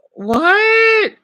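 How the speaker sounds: high, squeaked disbelieving what; a stunned yelp of disbelief; shocked. Real, not acted. Vlogger stunned by a quiz sentence, shocked high what.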